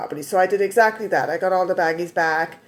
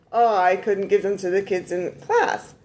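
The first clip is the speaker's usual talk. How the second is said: usual speaking voice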